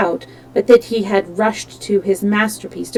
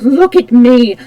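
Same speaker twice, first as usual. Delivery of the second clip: loud, slow roar of triumph; roared boast, stretching each word; 5 dB louder, slower than usual. Acted. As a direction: bellow it out, gloating. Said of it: "he roared".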